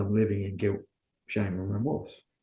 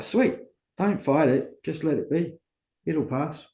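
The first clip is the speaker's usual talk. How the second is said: higher, slow and easygoing; calm sponsor advice; 6 semitones higher than usual. Retold or imitated. Sponsor telling angry Paul to let it pass, slow and calm. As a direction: reassure him, unhurried and relaxed.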